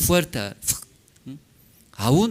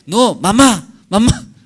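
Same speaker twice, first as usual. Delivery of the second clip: put-on much higher, louder sing-song to a baby; coaxing mother; 8 semitones higher, 7 dB louder than usual. Mother leaning over her baby, coaxing it to speak in a high sing-song. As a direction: coax the baby to say mamá.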